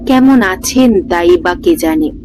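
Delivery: plain reading voice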